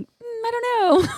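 high, slow, unsure hum; evasive. Retold or imitated. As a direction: dodge the question coyly. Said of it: "I'm like".

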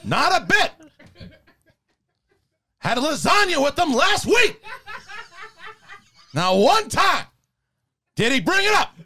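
high, emphatic movie-star impression in short bursts; Al Pacino. Actor insists his co-star is not Irish, high Pacino impression. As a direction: protest in halting high phrases, hammed up. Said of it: "Pacino just went from being like that really high voice".

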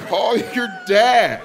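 very slow, high, sing-song. Retold or imitated. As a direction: stretch each word with breezy certainty.

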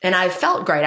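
ordinary delivery

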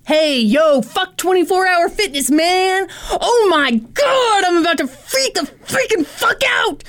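high, whiny meltdown with drawn-out words; hammed-up outraged ranting; [name]'s angry rant. Acting out his car vlog, melodramatic rant. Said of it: "I feel another rant coming on".

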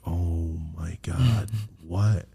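slow, low, drawn-out groan of dread; weary, sinking disbelief; mock dread. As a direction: drag it out, bracing for bad news. Retold dread at mom's text.

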